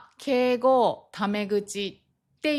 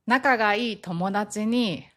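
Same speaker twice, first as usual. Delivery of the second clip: ordinary talk, nothing marked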